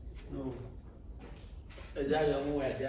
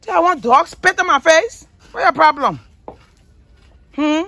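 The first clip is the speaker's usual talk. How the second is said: exasperated scolding; 12 semitones higher, 5 dB louder than usual. Real, not acted. Dog spit on her face.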